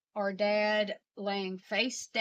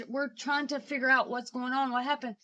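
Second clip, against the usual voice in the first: ordinary talk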